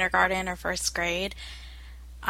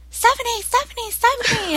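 much higher, louder frantic calls of a name; panicked child calling; 6 dB louder than usual. Retold or imitated. Little sister calling her big sister in alarm, high and frantic. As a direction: call out in alarm like a scared kid. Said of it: "she was like".

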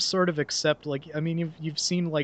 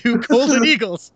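laughing through the words, louder and higher; 9 semitones higher, 9 dB louder than usual. Real, not acted. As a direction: chuckle out the school name in delight. Laughing about their own school.

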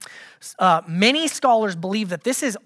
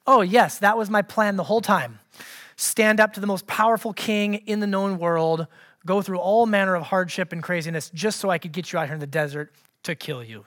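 sarcastic. Acted. Moses answering the people's accusation, dry sarcasm. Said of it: "I'm being sarcastic".

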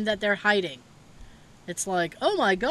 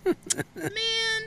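mock hurt; 12 semitones higher, 5 dB quieter than usual. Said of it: "And I went".

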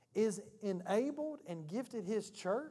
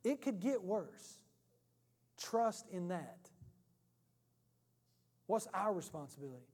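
ordinary talk, nothing marked

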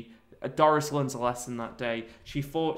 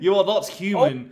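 mock dramatic reveal; 5 semitones higher, 7 dB louder than usual. Comic pitch, announcing Bean is a clone. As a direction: deliver the twist like a cheesy film. Acting mock film scene.

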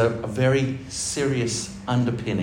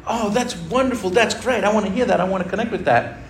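mock delighted response; 9 semitones higher than usual. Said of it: "out there are gonna be like".

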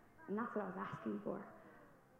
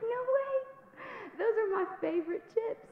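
much higher, louder disbelieving cry; shocked outburst; 13 semitones higher, 5 dB louder than usual. Retold or imitated. Hearing her favorite chips are off-limits, high outburst. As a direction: squeal it in disbelief. Learns hot Cheetos worsen it.

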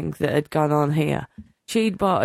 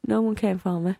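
usual speaking voice

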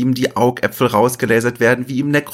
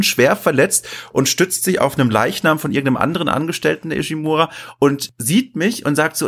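normal talking voice

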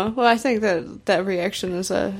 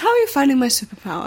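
higher, fast, sing-song hostess voice; air stewardess; 5 semitones higher, faster than usual. Retold or imitated. General Blue offering his power like a stewardess serving drinks. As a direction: chirp it like a flight attendant. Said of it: "saying it like an air stewardess".

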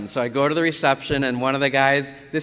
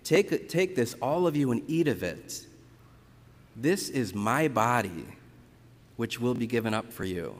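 words of consecration; 3 semitones lower, 4 dB quieter than usual. Shown as a demonstration. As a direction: recite it as at the altar, hushed. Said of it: "So when I say".

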